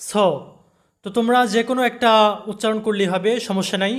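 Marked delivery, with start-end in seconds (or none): 0.1-0.1 স as "saw" with rounded British o; British accent. Shown as a demonstration. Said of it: "British অ্যাকসেন্টে হবে".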